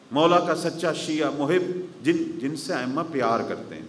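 usual speaking voice

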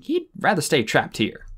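ordinary delivery

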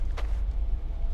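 breathless gasp of fright; sharp startled gasp. Real, not acted. Player checking a room, gasping in fright. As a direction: catch your breath in shock.

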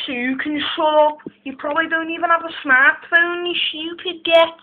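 put-on Scouse insult rant; Scouse accent. Shown as a demonstration. Scouser telling someone to shut up, Scouse accent. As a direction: tell them off in Scouse. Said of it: "and scouts".

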